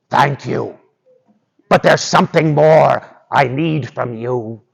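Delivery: hint at a wolf with a demand; bargaining wolf. Acted.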